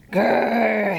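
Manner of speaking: low bear growl, lightly done; grizzled growl. Acted. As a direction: grumble grr like a woken bear. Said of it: "he grizzled".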